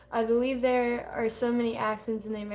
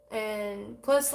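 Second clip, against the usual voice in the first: ordinary talk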